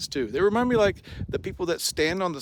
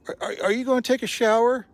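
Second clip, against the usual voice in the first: higher, louder eager question; higher-pitched eager camper; eager stranger; 9 semitones higher, 6 dB louder, faster than usual. Retold or imitated. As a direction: mimic a keen stranger calling over.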